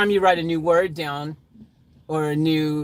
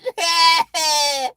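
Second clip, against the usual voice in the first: loud, high forced cackle; obnoxious laugh; 11 semitones higher, 8 dB louder than usual. Acted. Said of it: "she laughed it obnoxiously".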